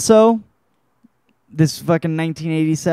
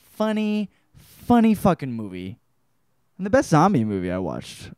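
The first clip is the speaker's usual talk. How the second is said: ordinary delivery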